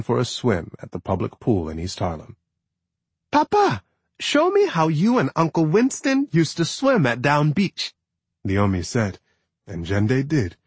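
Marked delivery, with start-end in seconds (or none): 3.3-7.9 put-on high eager little boy; child voice. A narrator's reading. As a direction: ask like an excited kid. Son Leomi asks his father.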